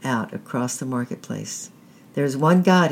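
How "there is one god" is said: loud proclaiming call; cried out. A narrator's reading. Priest calling out to the faithful, loud cry. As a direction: cry it out to the crowd. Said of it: "he cried".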